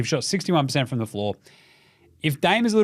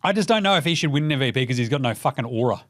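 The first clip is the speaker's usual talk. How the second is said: normal talking voice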